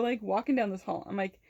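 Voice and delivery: ordinary talk, nothing marked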